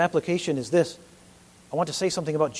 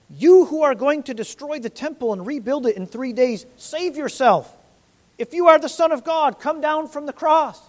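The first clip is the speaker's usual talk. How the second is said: loud, higher mocking jeer; mocking crowd; 7 semitones higher, 6 dB louder than usual. Preacher voicing crowd jeering at Jesus, mock jeer. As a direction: taunt like the crowd at the cross. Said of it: "Some of the things they said".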